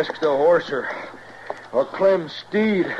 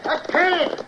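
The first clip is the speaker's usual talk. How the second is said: panicked; 10 semitones higher than usual. Acted. Rider on bolting horse.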